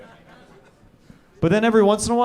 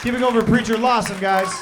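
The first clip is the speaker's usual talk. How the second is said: raised, higher stage call; host's applause call; 5 semitones higher than usual. Real, not acted. Host to crowd for Preacher Lawson, projected call.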